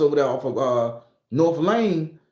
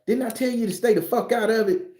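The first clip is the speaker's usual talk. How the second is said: higher, stern grandma rebuke; lightly voiced scolding grandma; 8 semitones higher than usual. Grandma scolding her grandson for meddling, high and stern. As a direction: scold him like grandma would.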